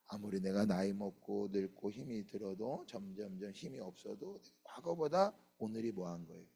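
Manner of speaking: ordinary talk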